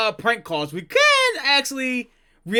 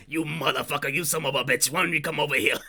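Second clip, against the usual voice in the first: lightly voiced angry old barkeep, lower and softer; mock-tough taunt at a caller, half under the breath; prank caller voice; 5 semitones lower, 4 dB quieter than usual. Reacting streamer imitating the prank call victim's angry voice. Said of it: "I always like these type of voices".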